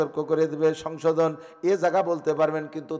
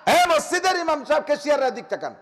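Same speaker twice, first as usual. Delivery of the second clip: high, loud preaching cry; fiery sermon; 12 semitones higher, 6 dB louder than usual. Acted. Preacher thundering at the mosque committee, loud and high. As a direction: lift the voice and hammer it at the crowd. Waz preacher at full force.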